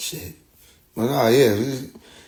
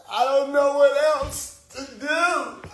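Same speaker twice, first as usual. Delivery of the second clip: high, slow exasperated voice; mock flustered; 11 semitones higher, slower than usual. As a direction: act helpless. Acting out flustered self.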